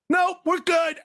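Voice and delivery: very high, hurried brush-off; mock alarm, voice shooting up; panicked refusal. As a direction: squeak it out, desperate to get away. Acted panicked refusal before running.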